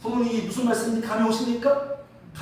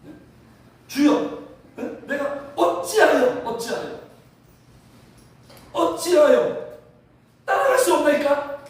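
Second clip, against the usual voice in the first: acted-out indignant challenge, raised voice; protesting; 4 semitones higher, 3 dB louder than usual. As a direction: push back loudly, demanding an answer. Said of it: "그냥 항변하고 막 따지듯이 그냥". (따지듯이 항변하며)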